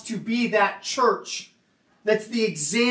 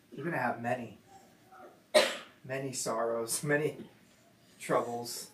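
hushed Jesus voice; 9 semitones lower, 11 dB quieter than usual. Retold or imitated. Jesus telling his disciples of troubles, hushed. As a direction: gently break the hard news.

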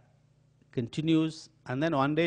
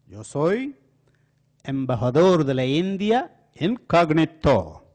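grand boast; 4 semitones higher, 10 dB louder than usual. Retold or imitated. Crook insists grandly on his story.

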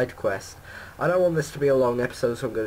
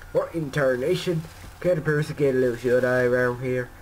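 put-on Southern accent; 3 dB louder than usual. Woken Talon complains about his lost shut-eye, put-on Southern accent. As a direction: grumble it in a hammed-up Southern accent. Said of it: "So sorry if that's your accent".

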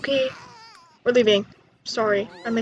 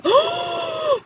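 a sudden, very high gasp of surprise; sharp high gasp; 3 dB louder than usual. Real, not acted. A high surprised gasp on seeing the baby.

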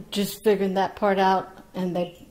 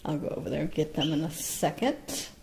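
ordinary talk, nothing marked

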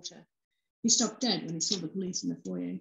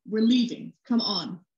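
louder, pushing the partner out; urgent command; 2 semitones higher, 6 dB louder, slower than usual. A narrator's reading. Detective hurrying his partner out of the house, louder. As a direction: order her out, no argument.

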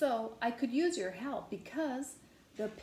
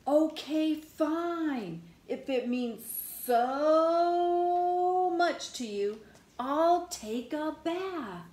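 high, hugely stretched "so"; exasperated giving in; 5 semitones higher, slower than usual. Acted. Pigeon agreeing to a bath, exasperated giving in. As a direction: give in dramatically, dragging the words out. Pigeon grudgingly gives in to bathing.

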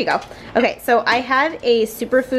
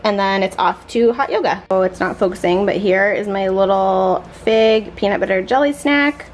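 ordinary talk

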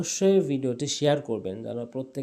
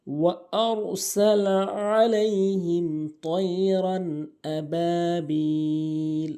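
slow, melodic tajweed chant; Quran recitation; 2 semitones higher, 4 dB louder, much slower than usual. Shown as a demonstration. Teacher reciting the first verse for learners, measured Quran recitation. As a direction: recite with long, held vowels.

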